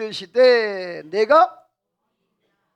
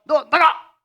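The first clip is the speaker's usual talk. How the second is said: louder, higher retold scolding yell; scolding shout; 5 semitones higher, 7 dB louder, slower than usual. Father scolding his son, retold shout. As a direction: bark it at a misbehaving son. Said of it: "막 야단치다".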